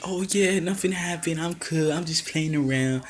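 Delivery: airy imitation of someone unbothered; mock nonchalance. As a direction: play it off as if all is fine. Acting out wrestler feigning she's fine.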